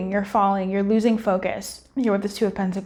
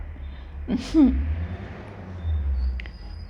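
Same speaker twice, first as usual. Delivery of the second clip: long low laugh; 5 dB quieter than usual. Real, not acted. Laughs at her own swearing.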